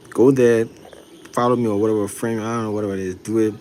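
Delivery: ordinary delivery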